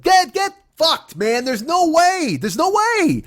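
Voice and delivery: very high, strained outburst of disbelief; losing his mind. Retold or imitated. Boyfriend rejecting girlfriend's excuse, losing his mind. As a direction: cry out in outraged refusal, pitch way up. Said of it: "I lost my mind".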